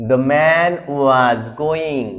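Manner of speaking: very slow, word by word; slow dictation. Shown as a demonstration. Teacher reading the example slowly. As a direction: read it out slowly for the class. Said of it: "দ".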